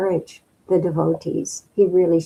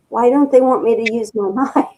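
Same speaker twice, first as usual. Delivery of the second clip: laughing disbelief; 6 semitones higher, 5 dB louder, faster than usual. Retold or imitated. Mock-suspicious quote of own thought.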